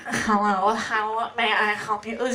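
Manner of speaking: a hint of a stuffed-up nose, slow; plugged-up sick voice. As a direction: lightly mimic a coworker with a blocked nose. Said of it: "she always sounds like she's sick, like she's plugged up".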